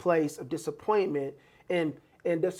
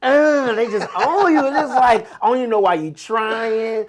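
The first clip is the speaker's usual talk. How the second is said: raised, louder scornful put-down voice; mocking discouraging relative; 4 semitones higher, 7 dB louder than usual. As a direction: scoff at their dreams, louder and higher.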